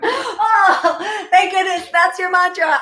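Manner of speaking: high, laughing burst of relief; real delighted, relieved laughter. Real, not acted. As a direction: laugh through the words, pitched right up. Laughs with relief on finding mantra.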